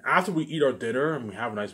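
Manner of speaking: usual speaking voice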